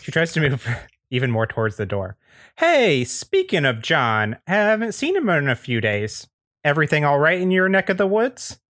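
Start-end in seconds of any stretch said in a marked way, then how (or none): 2.6-8.6 high, folksy neighbor; pry with high-pitched neighborly cheer; Andy Daly. Acted. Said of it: "is played by Andy Daly".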